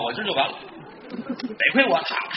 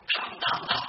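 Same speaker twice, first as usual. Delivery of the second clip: soft voiceless gunshot sounds; imitated gunshots; 7 dB quieter than usual. Storyteller mimics the pistol firing, soft and voiceless. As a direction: click out the shots under your breath.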